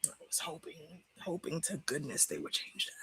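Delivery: quiet voice near tears; tearful. Real, not acted. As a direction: speak softly through tears. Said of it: "still in fucking tears".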